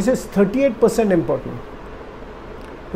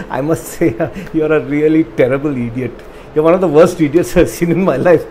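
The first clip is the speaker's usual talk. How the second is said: laughing insult. Shown as a demonstration. Teacher showing how tone softens an insult, laughing. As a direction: say the insult through a friendly laugh. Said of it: "That's how you say it".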